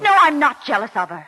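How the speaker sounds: heated denial. Acted. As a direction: push back hard and loud. Heated denial under interrogation.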